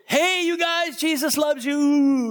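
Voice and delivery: high, slow call to the prisoners; shouting the message through the bars. Retold or imitated. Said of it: "and I said".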